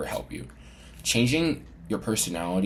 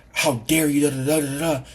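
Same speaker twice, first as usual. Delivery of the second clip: angry outburst; 8 semitones higher, 6 dB louder than usual. Retold or imitated. Acting out a blow-up.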